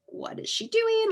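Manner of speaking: very high mock-bewildered husband voice; puzzled husband. Husband in the car watching his wife in a field, high mock bewilderment. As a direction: play the baffled onlooker in the car.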